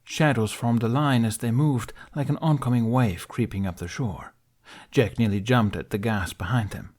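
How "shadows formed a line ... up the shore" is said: ordinary delivery, nothing marked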